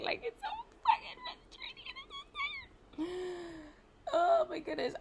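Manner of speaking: crying out the frustration. Real, not acted. Overwhelmed by it all, voice cracking high as she cries. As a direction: voice breaking high into a sob.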